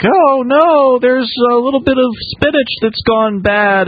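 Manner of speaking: high, whiny fake alarm; mock a fussy worrier in a high voice; mock panic. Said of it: "people are so upset about all this, like".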